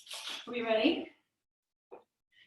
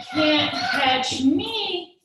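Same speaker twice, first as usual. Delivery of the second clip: teasing; 3 semitones higher, 7 dB louder, much slower than usual. Pretend. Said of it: "and they say".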